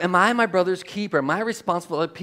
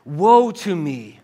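slow, loud cry of woe; 5 dB louder, slower than usual. Acted. Preacher reads Isaiah's cry before God, crying out. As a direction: cry out in dread, drawing it out. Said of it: "Isaiah cried out".

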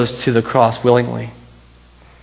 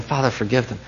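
"Father, forgive them" said much softer, hushed and pleading; hushed plea; 8 dB quieter than usual. Retold or imitated. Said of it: "he's crying out".

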